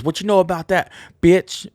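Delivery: loud high yelled lyric. Retold or imitated. Imitating the singer yelling the lyric, loud. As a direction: belt the line like the singer. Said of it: "he goes".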